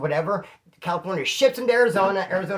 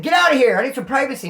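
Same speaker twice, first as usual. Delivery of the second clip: louder, higher pretend huffy shout; mock indignant; 8 semitones higher, 8 dB louder, faster than usual. Imagined telling the cop to leave, mock indignant shout. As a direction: shoo him off as if caught on the toilet.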